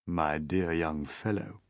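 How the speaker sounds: gentle. A narrator's reading. Old grasshopper gently addressing young James, low and slow. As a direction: speak kindly to the boy. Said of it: "said gently".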